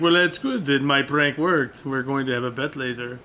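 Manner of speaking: slow, badly faked Austrian accent; hammed-up Austrian schemer, tickled by his prank; terrible Arnold impression. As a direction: put on a thick Austrian accent, take it slowly. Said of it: "My, my terrible impression".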